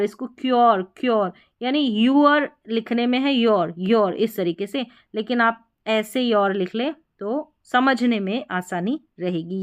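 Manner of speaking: ordinary talk, nothing marked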